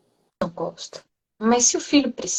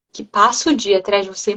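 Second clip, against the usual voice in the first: ordinary delivery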